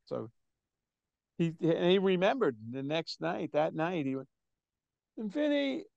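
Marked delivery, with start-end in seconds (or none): 5.2-5.9 high, hesitant stage introduction. Retold or imitated. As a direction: imitate a frontman nervously announcing a name. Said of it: "he was nervous".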